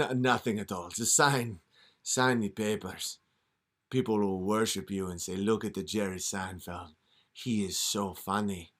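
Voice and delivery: a hint of a scheming devil; devil tempting. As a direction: coax him like a smooth devil. Comic plays tempting devil.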